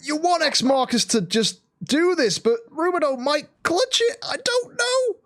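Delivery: high commentary climbing to a squeal; excited play-by-play. Real, not acted. Caster unsure who will clutch the fight, excited. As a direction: pitch climbing as the outcome hangs.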